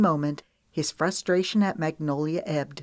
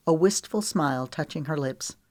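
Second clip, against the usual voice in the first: ordinary delivery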